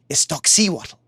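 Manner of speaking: ordinary delivery, nothing marked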